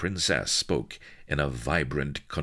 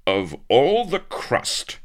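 loud, higher, slow indignant cry; outraged; 12 semitones higher, 9 dB louder, slower than usual. Acted. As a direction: splutter with offended shock. Avery outraged at her cheek.

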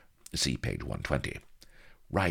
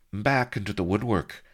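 higher-pitched mock humility; humble; 7 semitones higher than usual. A narrator's reading. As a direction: play the humble wretch. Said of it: "said Blood humbly".

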